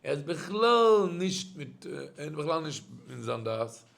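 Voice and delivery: ordinary talk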